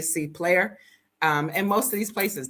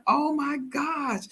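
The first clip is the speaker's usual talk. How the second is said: slow amazed gasp; 9 semitones higher, slower than usual. Retold or imitated. Seeing chickens.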